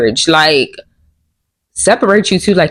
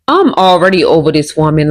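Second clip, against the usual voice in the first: usual speaking voice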